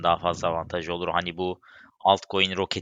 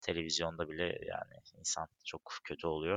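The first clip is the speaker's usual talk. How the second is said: subdued condolence; 2 semitones lower, 11 dB quieter than usual. Real, not acted. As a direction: offer condolences quietly and heavily. Condolences for earthquake victims.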